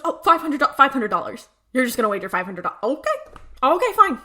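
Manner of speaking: loud, incredulous repeating, then giving in; mock exasperation. Dean reacting to his brother's bet, loud exasperation. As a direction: throw up your hands in exasperated surrender.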